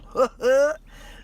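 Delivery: bright burst of laughter; laughing. Real, not acted. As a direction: laugh at your own slip. Laughs at own slip.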